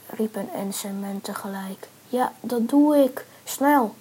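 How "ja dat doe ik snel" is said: loud, raised call. A narrator's reading. Man and trapped friends shout to each other, calling out. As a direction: shout it so it carries. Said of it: "riepen Men".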